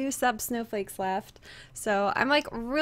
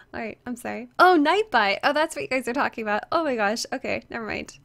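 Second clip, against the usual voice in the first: ordinary delivery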